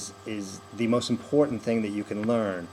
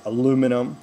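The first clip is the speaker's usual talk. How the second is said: "aluminum" with a strong "LU", louder; second-syllable stress; 6 dB louder than usual. Shown as a demonstration. Coach offering a favorite word, American stress on "LU" in "aluminum". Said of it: "We hit two".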